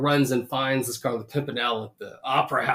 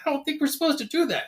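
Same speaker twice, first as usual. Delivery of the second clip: high, quick mock protest; objecting counter guy; 12 semitones higher, much faster than usual. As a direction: object fast in a high little voice. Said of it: "And the guy's like".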